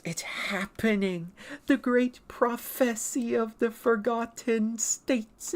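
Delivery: slow, high prophetic proclamation; Nasaris. Acted. Dinosaur announcing the great prophecy, slow proclamation. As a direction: proclaim it grandly, every word stretched. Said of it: "there is Nasaris. He goes".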